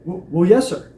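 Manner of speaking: stammered, caught-off-guard answer; officer caught off guard, stammering answer; dumbfounded. Retold or imitated. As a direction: stumble into it, taken aback. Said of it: "he looks at me dumbfounded".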